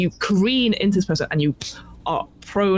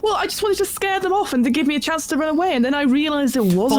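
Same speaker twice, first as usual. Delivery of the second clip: high-pitched flustered explanation; acted anxious self-defence; defensive excuse; 8 semitones higher than usual. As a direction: blurt out a justification in one run. Hunter justifies shooting to captors.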